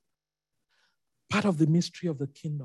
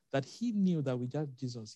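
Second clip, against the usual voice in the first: usual speaking voice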